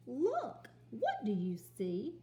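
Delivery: exclaim, then ask; excited then asking. Shown as a demonstration. Teacher reading the story aloud with expression. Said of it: "so say it with excitement".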